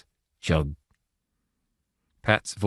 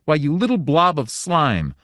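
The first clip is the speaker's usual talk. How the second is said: high, louder scornful fury; wrathful insult; 8 semitones higher, 4 dB louder than usual. A narrator's reading. Wife raging at the little god, higher and wrathful. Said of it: "shaking with wrath".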